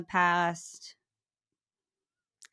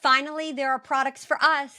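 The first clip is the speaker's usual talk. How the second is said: higher, brighter-pitched payoff of the pitch; excited ad line; 6 semitones higher than usual. Acted. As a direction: land the slogan with a lift. Co-host punchline of ad.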